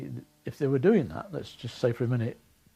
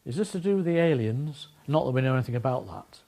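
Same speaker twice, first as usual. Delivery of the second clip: imitating official's inflection; 4 dB louder than usual. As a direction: mimic her pointed question and quick disclaimer. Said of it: "tone and inflection in her voice".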